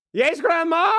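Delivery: high, loud call back from the door; dutiful grandson. Acted. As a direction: answer grandma's yell as her grown grandson. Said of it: "sticks his head out of the door and goes".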